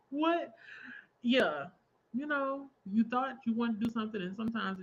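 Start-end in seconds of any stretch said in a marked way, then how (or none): 0.1-0.5 high, loud baffled "what?"; incredulous. Acted. Streamer reacting to where they ended up working, incredulous. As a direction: pitch it up in mock disbelief. Baffled at own career path.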